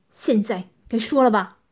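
提高音量，冷硬催逼; 压着再起的怒火厉声逼供; 冷冷逼问. A narrator's reading. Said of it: "冷冷地说道". (冷冷地大声质问)

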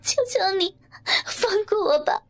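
high, crying plea; tearful begging. Acted. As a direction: beg through tears. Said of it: "泪汪汪地哀求着".

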